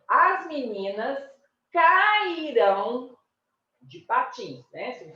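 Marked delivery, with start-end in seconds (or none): 0.1-3.2 "cairão" drawn out slowly, higher and louder; final-syllable stress. Shown as a demonstration. Teacher contrasting the future form, emphatic final stress. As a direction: stretch "cairão" onto a strong final ÃO.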